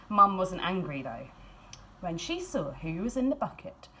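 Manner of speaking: ordinary delivery, nothing marked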